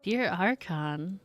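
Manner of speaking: ordinary delivery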